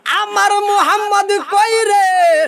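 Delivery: high, ringing chant of anguish; impassioned searching wail; wailing cry. Acted. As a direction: cry out for the missing child at high pitch. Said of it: "কান্দে আর কয়".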